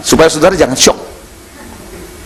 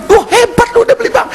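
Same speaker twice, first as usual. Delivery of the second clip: much higher, astonished voice; amazed. Retold or imitated. Preacher retelling his amazement at his friend's bank. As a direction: gasp at the news. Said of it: "retelling his amazed reaction".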